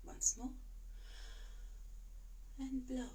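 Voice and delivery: soft coaching. Shown as a demonstration. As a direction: keep it hushed and calm. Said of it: "much more calmly".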